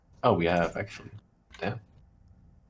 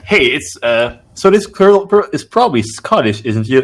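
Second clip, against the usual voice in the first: loud, raised-pitch retold voice; excited mock announcement; 5 semitones higher, 6 dB louder than usual. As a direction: imitate someone proclaiming a discovery. Voices meme-makers announcing a find.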